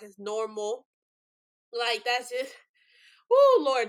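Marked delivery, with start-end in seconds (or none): 3.3-3.9 high, loud burst of dismay; overwhelmed exclamation. Real, not acted. Host reacting to the documentary, loud overwhelmed cry. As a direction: let it out like it hit you hard.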